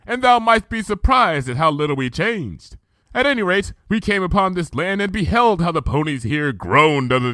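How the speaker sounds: measured, archaic royal speech; Luna's regal voice. Acted. Luna recounting the past to Twilight, stately.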